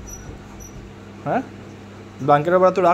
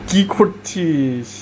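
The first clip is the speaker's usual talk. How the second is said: very slow, stretched-out teasing question; slow cooing question to the pet; drawn-out coo; much slower than usual. Real, not acted. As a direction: draw each word out slowly to the pet.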